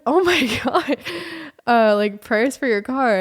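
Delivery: high, loud put-on oh my God; exaggerated dramatic sympathy, imitated; mock sympathy. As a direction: ham up the pity for the car. Friends react to car woes.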